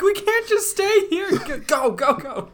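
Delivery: high, panicked urging; frantic character voice. Retold or imitated. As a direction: voice it like a panicking man. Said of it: "He's like".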